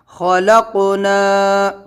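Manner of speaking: خلقنا drawn out, recited tune; آہستہ تلاوت; slow recitation. Shown as a demonstration.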